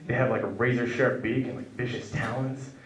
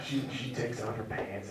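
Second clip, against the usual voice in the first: ordinary talk, nothing marked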